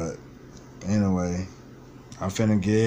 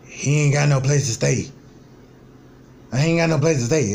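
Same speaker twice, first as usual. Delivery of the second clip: a hint of a whiny uncle, higher and faster; mock uncle; 4 semitones higher, 3 dB louder, faster than usual. Vlogger mimicking his uncle's excuses, mildly whiny. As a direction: lightly mimic the broke uncle complaining. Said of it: "That's exactly how he talk too".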